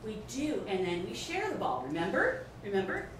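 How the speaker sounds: put-on soft, sing-song teacher to child; preschool teacher voice. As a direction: talk down gently to a small child.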